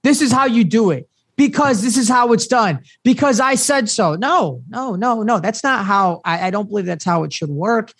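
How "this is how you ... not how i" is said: higher-pitched, insistent mock lecture; mock bossy parent. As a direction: imitate a parent laying down the law. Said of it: "instead of being like".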